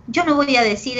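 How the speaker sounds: usual speaking voice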